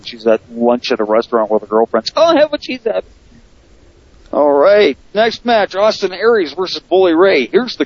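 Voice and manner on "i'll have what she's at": high, squeaky lady voice; quoting a lady. Retold or imitated. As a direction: mimic a woman in a commercial. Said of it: "that commercial of the lady who needs glasses".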